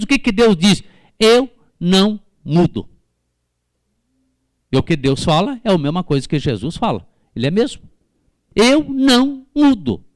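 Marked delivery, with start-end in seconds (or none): 1.2-2.9 "Eu não mudo" slow, each word punched; emphatic, word-by-word proclamation; God's firm declaration. Acted. Voicing God's declaration to congregation. 8.6-10.0 "Eu não mudo" high and slow, word by word; raised, deliberate preacher's proclamation; God's emphatic declaration. Acted. Repeating God's declaration to congregation.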